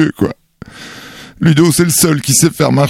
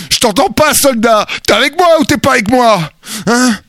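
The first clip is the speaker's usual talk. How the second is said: drill sergeant; 7 semitones higher than usual. Acted. Drill sergeant rallying soldier.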